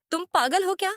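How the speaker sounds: high, incredulous snap; startled high-pitched "are you crazy"; shocked outburst. Retold or imitated. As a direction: react like he's lost his mind. Shocked teacher refuses shirt request.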